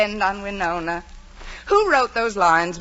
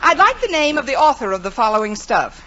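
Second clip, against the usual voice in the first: normal talking voice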